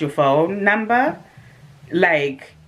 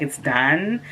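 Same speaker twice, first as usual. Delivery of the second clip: ordinary talk, nothing marked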